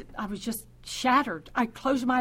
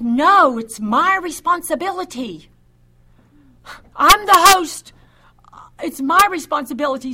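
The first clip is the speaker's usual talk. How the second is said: louder, higher, emphatic insistence; imitating an insistent brother under pressure; insistent refusal; 3 semitones higher, 7 dB louder than usual. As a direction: refuse firmly, raising your voice as you take charge. Brother refuses to hand child over.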